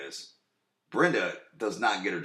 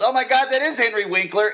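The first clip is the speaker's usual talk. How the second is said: high, loud imitation of a startled driver; mock astonishment; 13 semitones higher, 7 dB louder than usual. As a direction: blurt it out like a stunned celebrity spotter. Retells Brenda's startled sighting while driving.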